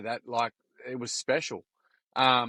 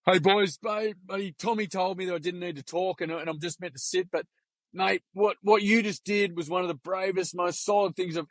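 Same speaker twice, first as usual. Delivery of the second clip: higher-pitched voice of an imitated speaker; earnest retold address; 6 semitones higher than usual. Player jumps up to praise bullied boy, high and earnest. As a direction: lift the pitch, speaking up for someone.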